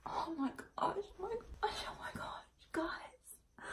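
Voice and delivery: whispered, near-tearful oh my gosh; breathless scared whisper on the edge of crying; real panic. Real, not acted. Showing viewers her ruined hair, whispering in real panic. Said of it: "I am so afraid right now".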